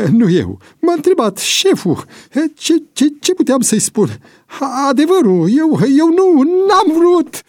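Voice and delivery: very high, stammering excuses; panicked pleading. A narrator's reading. Cornered informer backing away from the crowd, panicked pleading.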